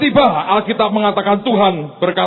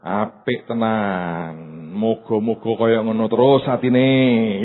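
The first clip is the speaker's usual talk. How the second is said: slow ironic Javanese; 6 semitones lower, slower than usual. Acted. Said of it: "terjemahan saya".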